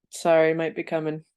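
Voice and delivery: ordinary talk, nothing marked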